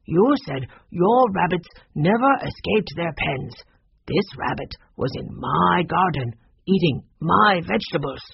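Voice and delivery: measured, emphatic character voice; aggrieved neighbour stressing each point; indignant gardener. Acted. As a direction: make a stern accusation, word by word. Voices Mister Yi in the story.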